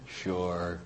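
low, very soft reply; reluctant consent. Retold or imitated. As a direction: give in under your breath, half-hearted. Reluctantly agrees to be prayed over.

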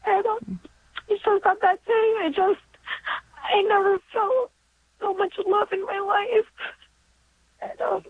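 high voice, close to crying; choked up, halting with emotion; real tearful emotion. Real, not acted. Caller tells of love for her daughter, tearful. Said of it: "wasn't expecting to get so emotional".

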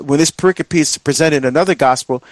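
ordinary delivery

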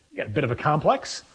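ordinary talk

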